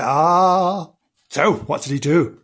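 plain reading voice

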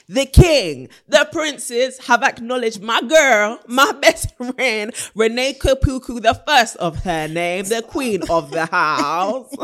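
loud, high royal build-up; hyped announcement. Real, not acted. Hyping up her award-winning friend.

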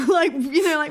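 high, loud laughing "like, you know"; laughing burst. Real, not acted. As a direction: crack up mid-sentence. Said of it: "describe a dog's crying as moaning".

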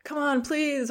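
high whiny plea; pleading child. Retold or imitated. Child begging parent, mock whine. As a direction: beg like a nagging kid. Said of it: "if you say".

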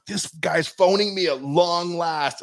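high, drawn-out thrilled exclamation; re-enacted excitement. Fan at the pub seeing the awaited call, acted excitement. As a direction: relive the thrill, slowly and high.

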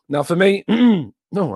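hoarse, losing voice; strained, hoarse throat. Real, not acted. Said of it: "I'm losing my voice already".

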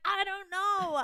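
high, loud, petulant whine; hammed-up stroppy outburst; re-enacting a frustrated tantrum; mock exasperated wail. Retelling the quarrel with James.

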